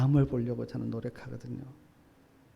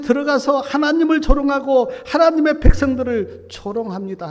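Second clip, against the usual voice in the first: anguished lament; 8 semitones higher, 8 dB louder than usual. Acted. Jeremiah's lament voiced in sermon.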